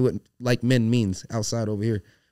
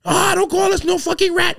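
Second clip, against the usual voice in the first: much higher, louder mocking shriek of the angry friend; caricatured friend going off; angry buddy; 11 dB louder than usual. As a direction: squeal it like an offended guy going off. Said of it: "so his buddy was like".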